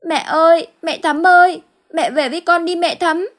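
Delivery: put-on high, loud child's wail for mother; a little girl crying out for her mum, very high and loud; child calling mother. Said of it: "gọi mẹ vang cả góc làng". (bé gái gào gọi mẹ, giọng rất cao và to)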